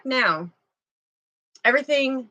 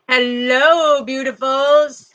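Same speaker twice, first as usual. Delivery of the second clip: loud sing-song hello; 3 semitones higher, 7 dB louder, slower than usual. Real, not acted. Greeting live viewers warmly.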